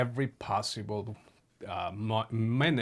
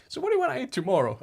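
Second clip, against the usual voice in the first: higher, louder and quick, fussing to feed him; eager caretaker; 12 semitones higher, 6 dB louder, much faster than usual. Retold or imitated. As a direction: rattle it off like a doting caretaker. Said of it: "So she'd be like".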